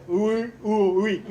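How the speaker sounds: mock gagged speech, vowels only; pistol-in-mouth talk. Speaker mimics forced agreement with a pistol in the mouth, mock gagged. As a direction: say it as if a pistol blocked the consonants. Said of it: "on ne peut prononcer que des voyelles".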